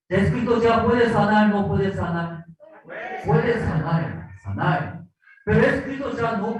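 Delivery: high, loud, eager village chatter; excited gossip. Acted. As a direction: gossip breathlessly about the healer. Townspeople gossip about Christ.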